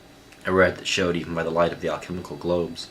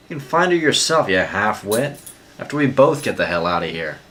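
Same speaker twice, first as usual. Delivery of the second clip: loud, much higher angry outburst; furious shout; 5 semitones higher, 9 dB louder than usual. A narrator's reading. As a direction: shout him out of giving up. Jean furious at Locke's surrender.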